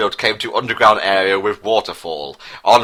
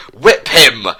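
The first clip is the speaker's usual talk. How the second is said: a sharp, louder angry order; angry yell; 3 semitones higher, 6 dB louder than usual. A narrator's reading. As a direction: bark the order at the druids. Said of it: "yelled Prince Harry with anger".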